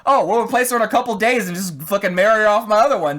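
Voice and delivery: breezy heartless father; callous dad. Retold or imitated. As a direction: toss it off, careless and loud.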